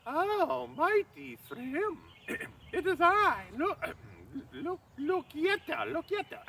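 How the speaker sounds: high falsetto handmaiden voice, slowed and fawning; Loki as a maid. Acted. Loki posing as a bridesmaid flatters the giant king in falsetto. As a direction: put on a simpering high maid's voice. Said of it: "and Loki goes".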